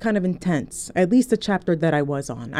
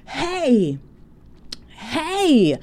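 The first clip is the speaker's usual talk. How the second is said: imitating her yell; 6 semitones higher than usual. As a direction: mimic a stranger shouting to get attention. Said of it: "she screams at the top of her lungs".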